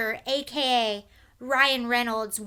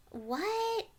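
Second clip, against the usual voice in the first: high, soft, drawn-out what; puzzled under-the-breath question; baffled; 9 semitones higher, 7 dB quieter than usual. Real, not acted. Host to viewers, reading the next tweet, baffled.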